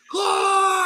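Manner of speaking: high, loud yell of the name. Retold or imitated. Derek spotting a player, yelling his name loudly. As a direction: call out across the crowd with no chill. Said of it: "He's like".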